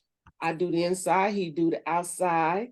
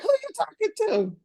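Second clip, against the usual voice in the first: much higher, mock outraged; sassy indignant retort. Wife replaying the argument with husband, high mock outrage. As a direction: toss it back with attitude. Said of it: "I was like".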